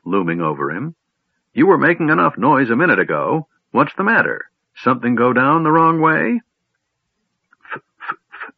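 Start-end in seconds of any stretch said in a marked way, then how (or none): 1.5-6.4 put-on high, loud teasing old voice; impatient old man. Old man mocking the kender's sudden silence, high and loud. 7.7-8.5 voiceless stuck F sounds; weak stutter. A narrator's reading. Stunned kender stammering at the old man, a voiceless weak stutter. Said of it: "stuttered Tas weakly".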